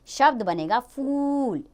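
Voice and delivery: फूल said slowly with the ऊ held; long ऊ stretched. Shown as a demonstration. Teacher showing children the long ऊ in फूल, stretched out. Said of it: "इसमें भी खिंचाव होगा".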